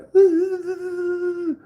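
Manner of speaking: high-pitched mock crying wail; a falsetto sob, hammed up. Imitating the neighbor breaking down crying.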